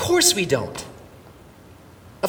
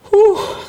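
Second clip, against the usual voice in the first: sigh of relief; 9 dB louder than usual. Retold or imitated. Relief on finding his lost son.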